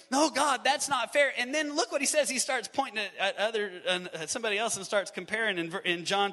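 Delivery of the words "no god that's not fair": raised, high protest; mock outcry of unfairness; Peter protesting. Pastor voicing Peter's complaint to Jesus, raised protest. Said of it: "Peter starts reacting".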